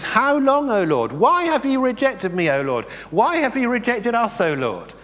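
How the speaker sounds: high pleading lament, rising on each why; anguished psalmist cry. Acted. Preacher voicing the psalmist's complaint to God, pleading and high.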